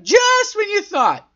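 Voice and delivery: high-pitched, drawn-out put-on voice; exaggerated singsong parody; mock dramatic. Shown as a demonstration. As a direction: stretch it out high like a silly echo. Mocks own phrase after hearing it.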